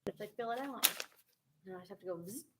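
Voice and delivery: ordinary talk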